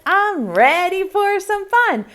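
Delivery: high, gleeful little pumpkin; excited pumpkin voice. Acted. Fifth pumpkin in the poem, high and gleeful. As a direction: squeal it like a pumpkin ready to party. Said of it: "The fifth one said".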